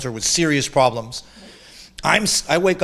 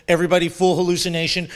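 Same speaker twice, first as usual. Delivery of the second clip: ordinary talk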